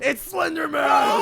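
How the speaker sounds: high, loud excited shout. Real, not acted. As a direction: yell the name in mock terror. Players shout the name.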